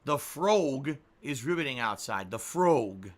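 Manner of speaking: "frog" drawn out as "frohg"; slow, exaggerated "oh" in "frog"; supposedly correct "frog". Shown as a demonstration. Streamer mocking the correction in a sentence, rounded "frohg". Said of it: "it's frog".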